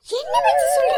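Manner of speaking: very low, loud man's voice; gruff man shouting. Acted. Man snapping at the gossiping woman, low loud shout. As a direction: bark it like an angry man.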